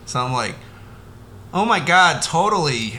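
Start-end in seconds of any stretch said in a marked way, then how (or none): none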